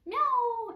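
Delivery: high, loud playful meow; cat meow imitation. Shown as a demonstration. Teacher meows for the class to copy. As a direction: mew like a little cat. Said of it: "ऐसे, ऐसे बोलती है".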